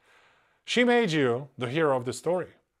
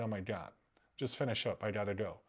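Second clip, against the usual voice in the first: hushed, impatient mock of her, much softer; bored mock girlfriend; 5 semitones lower, 11 dB quieter, faster than usual. Man mocking the woman's bored attitude in bed, hushed. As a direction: mutter it like she just wants it over. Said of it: "That's her attitude".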